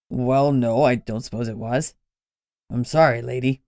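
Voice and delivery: mean the apology; sincere apology. Acted. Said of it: "He sounded sincere".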